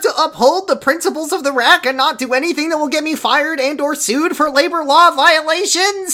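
high, grandiose officiating voice; hammed-up ceremonial oath; mock solemn oath. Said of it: "And then he goes".

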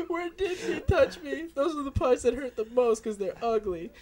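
put-on whiny teen voice. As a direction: whimper it like a hurt teen. Playing the teen answering.

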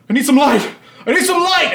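very high, loud cry for help; panicked yell. Acted. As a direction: scream it in rising panic. Lone knight panics in the dark.

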